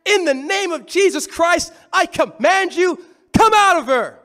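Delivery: Paul's rebuke read aloud, swelling loud at the end; read commanding exorcism. A narrator's reading. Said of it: "said to his spirit".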